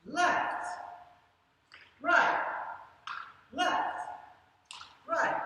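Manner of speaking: high, called-out words; calling out directions. Shown as a demonstration. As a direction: call each direction out while leaping. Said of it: "we're gonna say loud".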